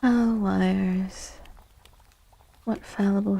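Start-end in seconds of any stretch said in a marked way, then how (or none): none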